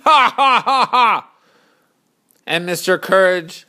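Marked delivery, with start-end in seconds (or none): 0.0-1.3 short burst of loud, high ha-ha; mock crowd laughter. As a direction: jeer with a big fake laugh. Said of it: "they all laugh again".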